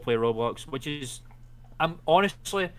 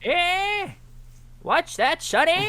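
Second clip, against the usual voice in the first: put-on high creaky old man, half-deaf; grumpy old geezer cupping his ear; ear trumpet; 4 dB louder, much slower than usual. Playing geriatric old men.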